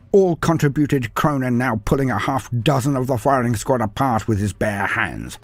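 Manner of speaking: usual speaking voice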